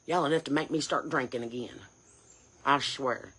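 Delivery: low, quiet grumble to yourself; exasperated aside. Real, not acted. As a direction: drop low and soft, fed up. Worn-out aside after angry rant.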